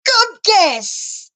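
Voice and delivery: very high, sudden exclamation; high-pitched mimicked quip; imitated playful reply. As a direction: pitch it way up, imitating his answer. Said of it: "Like that, exactly like that".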